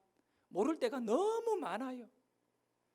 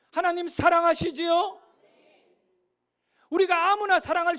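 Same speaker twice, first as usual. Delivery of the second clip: loud, high, impassioned sermon delivery; full-force preaching; 6 semitones higher, 10 dB louder than usual. Acted. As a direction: preach it out loud to the whole church. Preaches to the congregation. (힘차게 외치는 설교조)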